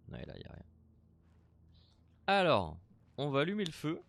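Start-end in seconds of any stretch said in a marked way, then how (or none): 0.1-0.6 whispered aside. Real, not acted. Player whispering while searching. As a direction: say it under your breath.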